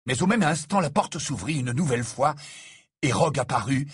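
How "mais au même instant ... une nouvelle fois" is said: ordinary delivery, nothing marked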